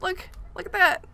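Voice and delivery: high, amused mock aside to herself; noticing, then dismissing. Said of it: "I was like".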